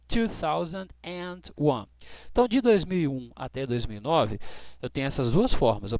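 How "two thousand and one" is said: slow, careful "two thousand and eight"; slow model. Shown as a demonstration. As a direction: read the year out slowly.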